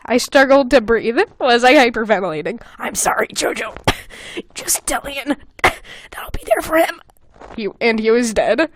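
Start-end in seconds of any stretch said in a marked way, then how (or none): 2.8-7.0 dying whisper. Acted. Dying friend's last words.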